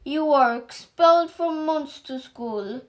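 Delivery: stern expulsion. Acted. Ogre headmaster expels pupil.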